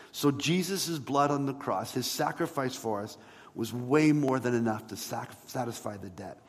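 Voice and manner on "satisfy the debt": ordinary talk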